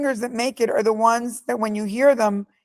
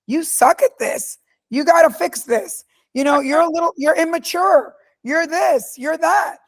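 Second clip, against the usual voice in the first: a touch higher, mildly imitating a harsh boss; retold boss scolding; 6 semitones higher than usual. Tough boss tearing into a young employee, mild mock scold. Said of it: "that have yelled at me".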